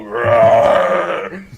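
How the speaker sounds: a retold T-Rex roar, mildly growled; hammed-up roar to mock a face; mock monster roar. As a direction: imitate a dinosaur roar for the joke. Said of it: "they go".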